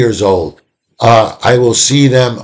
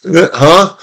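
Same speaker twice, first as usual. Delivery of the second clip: put-on questioning, confused child's "huh?"; baffled kid; 6 semitones higher, much slower than usual. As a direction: act out a kid suddenly thrown off, unsure. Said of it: "doesn't know what to do".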